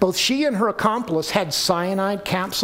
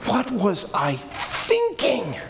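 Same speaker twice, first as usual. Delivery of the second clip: drawn-out, higher, rueful; slow despairing self-reproach; slow higher regret; 5 semitones higher, slower than usual. Pretend. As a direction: stretch it out, shaking your head. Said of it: "and you go".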